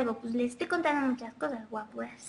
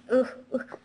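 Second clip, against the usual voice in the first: usual speaking voice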